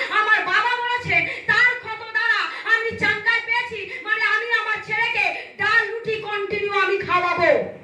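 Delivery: high, insistent declaration of faith; fervent preaching. Real, not acted. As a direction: proclaim it like a preacher in full flow. (উঁচু সুরে দৃঢ় বিশ্বাসের ঘোষণা)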